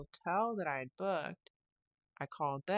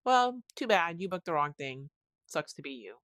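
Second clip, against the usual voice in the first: mock the agent brushing her off, dismissive; snarky tone; 8 dB louder than usual. Retelling the hotel agent refusing her, in a mocking snide tone. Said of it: "she didn't say it in that tone of voice".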